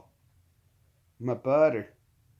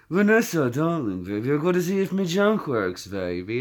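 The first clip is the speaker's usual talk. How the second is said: Austin Powers impression. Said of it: "He is the pimp of London".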